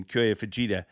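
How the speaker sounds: ordinary delivery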